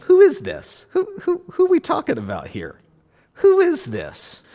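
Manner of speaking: a disbelieving crowd's loud, high-pitched challenge; incredulous crowd. Acted.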